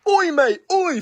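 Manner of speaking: high, loud mock Australian call; Australian gym teacher. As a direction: holler like an Australian breaking up a fight. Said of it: "hearing his voice go".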